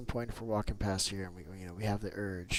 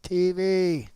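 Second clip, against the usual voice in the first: loud high call across the map; 8 semitones higher, 10 dB louder than usual. Real, not acted. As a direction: call a friend from far off. Calling out to teammate.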